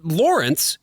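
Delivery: ordinary talk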